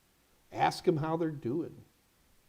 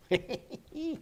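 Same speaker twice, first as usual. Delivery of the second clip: short laugh; 8 semitones higher, 5 dB quieter than usual. Real, not acted. Laugh after his point.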